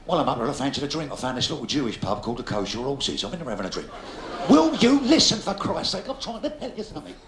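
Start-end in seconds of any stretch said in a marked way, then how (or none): 4.5-7.1 exasperated demand. Real, not acted. Comedian demands the audience listen.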